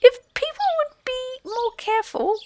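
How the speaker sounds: high, prim squeaky reproach; stiff field mouse. A narrator's reading. Field mouse primly scolding the fallen Rat, stiff and squeaky. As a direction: sniff at him in a tiny prim voice. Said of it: "said a field mouse rather stiffly".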